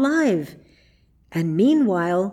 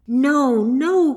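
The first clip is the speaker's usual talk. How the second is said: higher, louder pleading no; crying out; 5 semitones higher, 5 dB louder than usual. A narrator's reading. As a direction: cry out in protest, pitched up. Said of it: "cried Marjorie".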